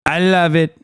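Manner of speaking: slow, drawn-out politician impression; Trump. Streamer explains sudden pandering to voters, mock Trump impression. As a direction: stretch it out in a mocking impression. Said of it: "Trump is like".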